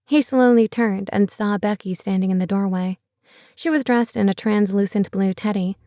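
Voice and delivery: usual speaking voice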